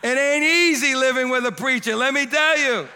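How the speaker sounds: high, loud joking call to the crowd; loud playful preacher. Real, not acted. Pastor loudly urges the church to applaud his wife. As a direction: rouse the room to cheer his wife.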